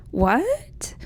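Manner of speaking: whispered rising question; mock shock. Mother learns it is her daughter, hushed mock shock. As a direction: squeak out a tiny, stunned whisper. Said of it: "she's like".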